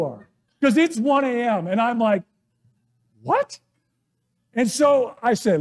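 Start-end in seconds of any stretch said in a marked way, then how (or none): 3.2-3.6 surprised what. Retold or imitated. Woken by wife's yell.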